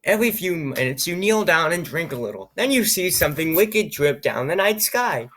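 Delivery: reading the adventure text aloud, lightly dramatized; story narration. Acted. Reads the game story text aloud.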